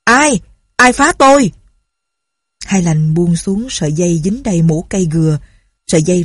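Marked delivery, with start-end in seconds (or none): 0.0-1.5 high, loud alarmed shout; startled loud cry. A narrator's reading. Official startled in darkness shouting who's there, loud. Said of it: "Cai tổng biện nói to".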